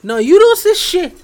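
high, loud, slow protest; yelling it in mock betrayal; mock outrage. To the friend who sold him out, a mock outraged yell.

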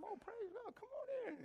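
high, gushing mock welcome; squealing delight at a visitor; eager welcome. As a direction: play the overeager believer at the door. Mock eager Jehovah's Witness.

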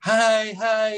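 high, lightly mocking polite "hi, hi"; imitating the actress who now avoids him; cool brush-off. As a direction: play her forced, distant greeting. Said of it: "She goes".

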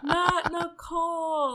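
high, slow, teary wail, half laughing; mock sobbing plea. Retelling her tears at the show, in a high mock sob. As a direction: ham up a tearful protest. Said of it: "I was just in tears crying".

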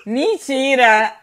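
loud, drawn-out disbelief; incredulous shout. Real, not acted. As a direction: cry out in laughing disbelief. Reage à resposta igual. (grito de incredulidade)

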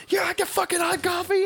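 high mock-angry taunt; enraged husband. Retelling the PSA husband taunting her, high mock rage. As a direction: ham up the husband's fury. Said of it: "And he's like".

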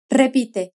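normal talking voice

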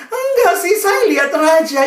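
high, loud tearful protest; mock sobbing child. Pupil answers her teacher in tears, mock wailing. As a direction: protest through acted sobs. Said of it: "dia nangis terseduh-seduh".

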